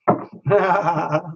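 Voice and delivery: high little run of laughter; light laughter. Real, not acted. Reader unsure what will happen, high laughter. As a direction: laugh at the unknown outcome. Laughs before unknown card.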